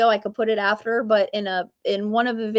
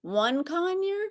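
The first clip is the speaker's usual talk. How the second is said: high, softer questioning rise; shocked; 7 semitones higher, 6 dB quieter, slower than usual. Real, not acted. Host recalling her single conure, shocked. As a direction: draw it out in rising disbelief. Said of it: "I'm very shocked".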